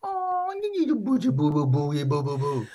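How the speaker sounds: put-on very high cooing baby talk; squeaky high baby babble. Shown as a demonstration. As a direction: coo it like talking to an infant. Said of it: "You give me an example".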